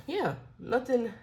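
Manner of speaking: usual speaking voice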